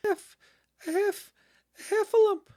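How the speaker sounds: very high, loud squeaky gasp; panic-stricken piglet squeak, word cut short; breathless Piglet. A narrator's reading. Piglet reaches Christopher Robin's house, breathless high squeak. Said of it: "breathing so hard that he could hardly speak".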